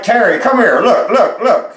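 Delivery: retold excited shout for Terry; excited calling out. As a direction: call her over, can't believe it. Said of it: "I'm like".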